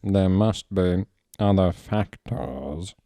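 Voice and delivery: slow, measured, lightly voiced; deliberate reasoning; a hint of Snape. Snape insisting there are other factors.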